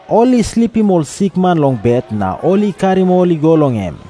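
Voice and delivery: ordinary delivery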